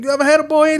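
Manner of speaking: high, loud imitation of a young kid; kid's voice. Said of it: "He's like".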